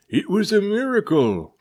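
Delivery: high, loud joyful cry; gruff sea captain crying out in joy; excited cry. A narrator's reading. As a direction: shout the miracle to the crew. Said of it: "cried the captain".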